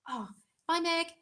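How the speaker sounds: usual speaking voice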